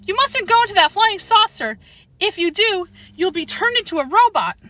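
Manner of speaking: put-on high, pleading girlish warning; girl's warning. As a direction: beg the dog not to go, voice up high. Girl warning poodle.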